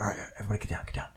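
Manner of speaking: an urgent whispered order to duck. Retold or imitated. Killer hushing crew in hiding.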